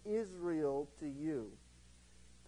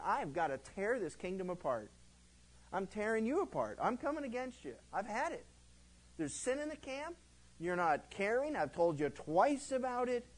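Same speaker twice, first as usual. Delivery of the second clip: fed-up prophet; 6 semitones higher than usual. Retold or imitated. Prophet of the Lord declaring judgment on the kingdom, furious and high. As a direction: thunder like a prophet who has had enough. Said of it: "a prophet of the Lord saying".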